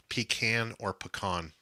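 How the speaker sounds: "Pecan or pecan?" as PEE-kan then puh-KAHN; pecan both ways. Shown as a demonstration. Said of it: "we need to start this debate".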